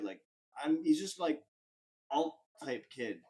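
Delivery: ordinary talk, nothing marked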